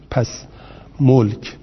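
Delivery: ordinary delivery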